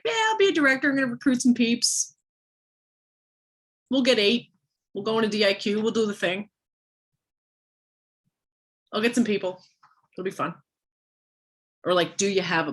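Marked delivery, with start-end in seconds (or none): 0.0-10.6 high-pitched mock airhead planner; willy-nilly. Coach imitates a director with no plan, mock willy-nilly. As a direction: toss it off high and carefree, mocking. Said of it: "Or are you just willy-nilly like".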